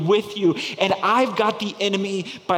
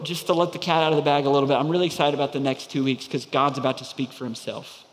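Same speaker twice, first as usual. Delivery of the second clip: ordinary talk, nothing marked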